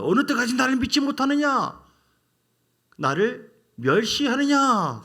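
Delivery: 높고 크게 외치는 하나님의 분노한 호통. Acted. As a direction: thunder it out as an angry God rebuking the people. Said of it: "하나님 막 열을 받으세요". (분노에 찬 호통)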